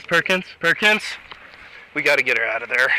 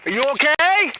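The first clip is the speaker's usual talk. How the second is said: raised urgent voice, calling to her; 8 semitones higher than usual. Real, not acted. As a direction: call out to wake her. Officer calling out to unconscious driver.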